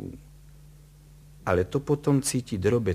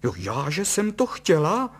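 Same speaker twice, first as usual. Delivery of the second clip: high-pitched indignant retort; affronted wife, voice raised; boiling over; 8 semitones higher, 4 dB louder than usual. A narrator's reading. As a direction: flare up at the accusation. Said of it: "vzkypěla paní Kalousová".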